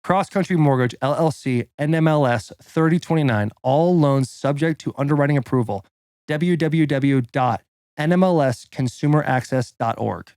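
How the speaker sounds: punchy, deliberate announcer-style read; sponsor ad read. Acted. Podcast guest reading a mortgage ad, punchy and deliberate. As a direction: sell it line by line, stressing key words. Rereads the ad disclaimer.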